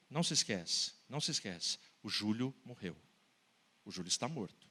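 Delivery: low, soft, grave aside; solemn refrain. Acted. Preacher reminding the crowd the boy is dead, low and soft. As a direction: drop the voice low and quiet, heavy.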